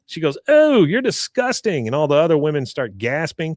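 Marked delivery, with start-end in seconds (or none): 0.5-1.9 high, drawn-out mock disgust; hammed-up appalled woman. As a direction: recoil with slow, high-pitched revulsion. Woman recoils from Bill in disgust.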